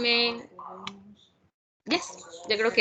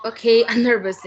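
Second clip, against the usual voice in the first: laughing nervously, a little louder; a jittery laugh before speaking; 6 dB louder, much faster than usual. Real, not acted. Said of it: "I'm so nervous".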